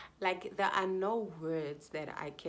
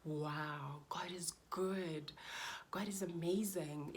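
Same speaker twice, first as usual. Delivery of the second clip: whispered praise, slow on good; retold whisper of thanks; awed whisper; 4 dB quieter, slower than usual. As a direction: breathe it in wonder. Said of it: "It was like".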